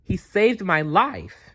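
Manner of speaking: high, excited, slowing on dazzling spines; exclaiming. Acted. Maya exclaims to the Quetzalcoatlus about Dazzle, high and excited. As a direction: show off your friend proudly.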